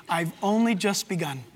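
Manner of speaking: usual speaking voice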